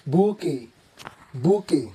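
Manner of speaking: "bouquet" as boo-kay, silent t; correct bouquet. Shown as a demonstration. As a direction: say "bouquet" with plain k, ending in ay. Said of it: "giving these a soft and elegant sound".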